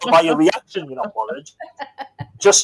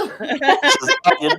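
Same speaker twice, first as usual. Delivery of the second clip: higher put-on voice through a laugh; sing-say it as a laughing send-up; mock singer line; 8 semitones higher than usual. Host echoes the singer's line laughing, higher put-on voice. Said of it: "you hear [name] saying".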